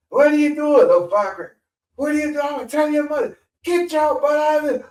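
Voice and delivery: put-on high furious pastor chewing out kids; angry pastor. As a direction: scold the kids like a furious little reverend. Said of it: "he said".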